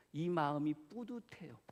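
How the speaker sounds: ordinary talk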